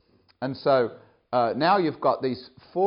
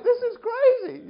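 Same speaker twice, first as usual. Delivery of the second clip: high, slow laughing; laughing disbelief; 4 dB louder, slower than usual. Retold or imitated. Camp director laughing at the news, high and slow. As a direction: laugh through it, amazed.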